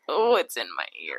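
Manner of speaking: low, hushed mock discomfort; imitated aversion to whispering. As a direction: drop low and soft, recoiling from a whisper. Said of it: "I hate when they whisper because I'm just like".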